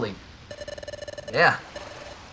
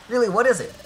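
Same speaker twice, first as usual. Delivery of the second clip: excited; 9 semitones higher, 4 dB louder than usual. Acted. Eager question to Dusknoir.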